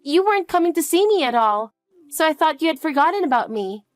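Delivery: put-on high, hurt little girl asking for her mom; a lonely child half-sulking at her mother.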